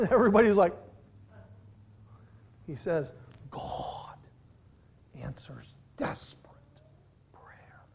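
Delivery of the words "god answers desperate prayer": slow, hushed whisper with long pauses; voiceless, weighty reveal; dramatic whisper. Retold or imitated. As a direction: whisper it word by word. Hushed payoff of the retold quote.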